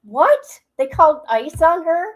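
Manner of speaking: high, loud astonished question; incredulous. Real, not acted. Host reacts to ice being called on her, incredulous. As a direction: repeat the claim in open disbelief. Disbelief at claim in clip.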